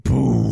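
low, loud mock explosion boom; explosion sound. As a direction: drop a deep trailer boom. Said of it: "you hear explosions in the back".

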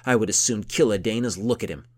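a hint of a hot-tempered count; temper rising. A narrator's reading. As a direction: let the anger build through the line. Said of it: "his temper gaining momentum".

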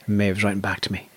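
normal talking voice

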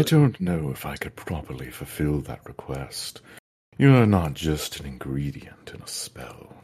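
low, breathy whispered lich voice. Acted. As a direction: rasp it low like an undead lich. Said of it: "I'll be happy to read it".

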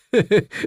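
high, uneasy little chuckle; nervous laugh. A narrator's reading. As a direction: laugh uncertainly, as if covering up. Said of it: "lachte unsicher". (unsicheres, hohes Lachen)

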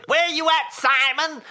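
high, loud put-on voice; Sean O'Malley. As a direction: imitate a fighter's call in a high, odd accent. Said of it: "he wasn't doing his normal voice".

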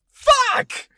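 high squealed kid imitation; very high mock-child cry. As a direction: yelp it like an over-eager child. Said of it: "it's getting louder".

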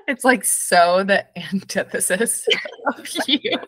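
slow, laughing through the words; real amused laughter breaking in. Real, not acted. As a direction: say it while cracking up. Host laughs at co-host's Southern persona.

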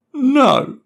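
high, loud defiant shout; shouted back. Acted. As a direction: throw it back up at dad. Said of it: "Matty shouted back".

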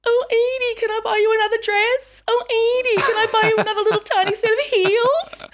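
very high, cooing self-parody; gushing auntie. Retold or imitated. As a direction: coo it sky-high like a doting auntie. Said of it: "But here's me going".

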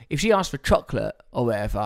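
ordinary delivery

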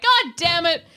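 fed-up villager groan; exasperated townsfolk. Retold or imitated. Voicing annoyed townsfolk.

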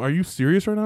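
high-pitched disbelieving question; retold incredulity. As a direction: act out being stunned by a silly request. Retold reaction to clueless caller.

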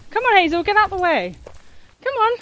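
high-pitched coaxing call to a chicken; real high sing-out to a hen in the way; calling to an animal. Real, not acted. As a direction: shoo a pet along in a high voice.

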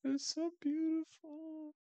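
overcome, very high and drawn out; weird and emotional. Real, not acted. Host gushing to co-host over the couple, emotional. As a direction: squeak it out slowly, choked up with emotion. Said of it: "be all weird and emotional".

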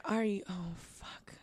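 trailing down to a whispered curse; retold mortified muttering, very soft; utterly embarrassed. Daughter hanging her head at the stranger, embarrassed. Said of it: "utter embarrassment for everyone in this situation".

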